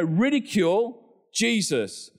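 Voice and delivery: ordinary delivery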